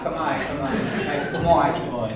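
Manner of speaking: slow laughing good moi repeated; laughing drill. Real, not acted. Teacher laughing while she repeats the phrase. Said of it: "I can't even say the thing wrong".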